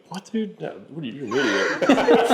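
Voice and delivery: disbelief that boils over; incredulous outburst. Retold or imitated. Dad reacting to son's betting.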